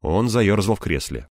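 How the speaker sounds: usual speaking voice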